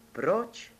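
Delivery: sudden, high-pitched "why"; blurted question. A narrator's reading. Shepherd boy to the fair maiden, blurting in surprise. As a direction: blurt it out with a sharp upward leap. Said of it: "vyhlkl Jirka".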